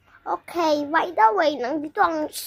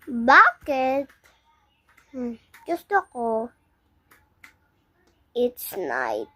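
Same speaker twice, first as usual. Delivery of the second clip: ordinary talk, nothing marked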